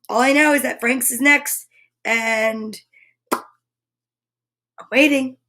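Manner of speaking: ordinary talk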